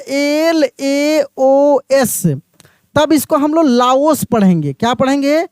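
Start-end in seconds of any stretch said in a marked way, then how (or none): 0.1-2.2 letters एल ए ओ एस spelled slowly, one by one; slow spelling out. Shown as a demonstration. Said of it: "लिखा रहता".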